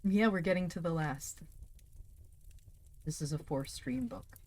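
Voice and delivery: ordinary delivery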